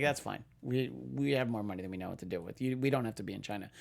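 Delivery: low, quick dismissive tone; smug shrug. Retold or imitated. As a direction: brush it off, smug and rich. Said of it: "And they're like".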